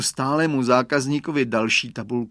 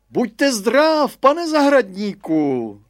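higher, louder greeting across the fence; called out; 10 semitones higher, 6 dB louder, much slower than usual. Acted. Headmaster greeting the gardener across the fence, loud high call. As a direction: call out a hearty hello from afar.

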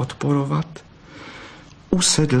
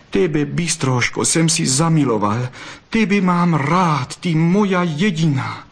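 passionate declaration; 7 semitones higher than usual. A narrator's reading. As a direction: confess love with rising fervour. Fox passionately declares his love. (vroucí vyznání lásky)

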